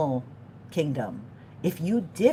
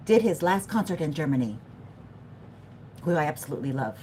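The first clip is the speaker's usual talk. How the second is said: ordinary talk, nothing marked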